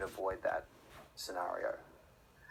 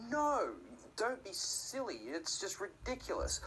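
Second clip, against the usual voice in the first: high-pitched, drawn-out mock refusal; exaggerated parental scolding in a high voice; protesting parent; 8 semitones higher than usual. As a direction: act out an exasperated no, pitched way up. Parent refusing child who wants poison.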